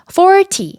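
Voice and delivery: "forty" with FOR high and stressed; stress on first syllable. Shown as a demonstration. Teacher modelling tens for learners, first-syllable stress. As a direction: hit the front of "forty". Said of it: "앞에 강조를 주셔야 돼요".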